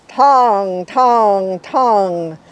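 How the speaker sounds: ringing bell tongs, loud and drawn out; booming bell sounds; bell imitation. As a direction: chime each tong like a big mission bell.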